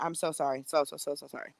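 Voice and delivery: soft string of sorries; quiet repeated apology. Real, not acted. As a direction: murmur sorry again and again. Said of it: "fading apology".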